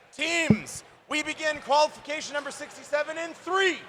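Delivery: announcer counts the teams into the match; countdown shout. Acted. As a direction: build the count into a big start call.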